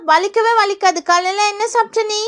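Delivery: ordinary delivery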